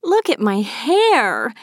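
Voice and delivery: high, slow drawn-out whine; moaning complaint. A narrator's reading. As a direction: wail it in dismay, stretching the words. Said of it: "Maeve moaned".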